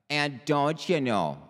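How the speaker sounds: put-on Minnesota voice; Minnesota accent. Shown as a demonstration. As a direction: slip into a folksy Minnesota accent. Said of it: "I have to slip into the Minnesota".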